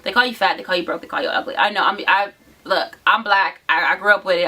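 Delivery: normal talking voice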